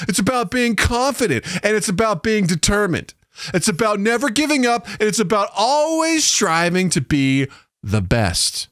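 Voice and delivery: put-on high, emphatic talking to a child; exaggerated lilt for a toddler; baby talk. Shown as a demonstration. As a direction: explain it brightly to a little one. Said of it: "pretending that you're talking to children".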